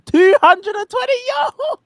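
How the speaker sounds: high-pitched hype cheer with laughter; excited hype. Real, not acted. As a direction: cheer your friend on like a hype man. Hyping up friend's view count.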